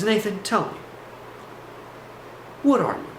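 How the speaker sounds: lightly voiced guru, high, with a long pause; guru talk. Guru questioning his visitor, lightly imitated guru talk. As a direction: pose it like a probing guru. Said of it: "what I call the guru talk".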